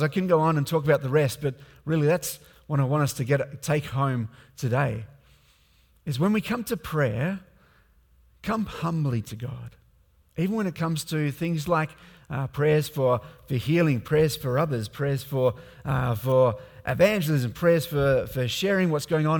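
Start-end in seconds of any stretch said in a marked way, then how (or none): none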